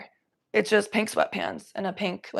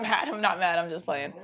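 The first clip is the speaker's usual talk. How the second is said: ordinary talk, nothing marked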